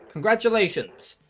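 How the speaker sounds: loud, slow game announcer; bright mock announcement. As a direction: proclaim it like a system message.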